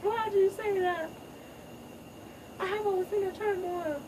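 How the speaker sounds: high, hammed-up sobbing protest; teary young lion pleading innocence; mock crying. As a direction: whine it through fake tears. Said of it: "Kobu's like".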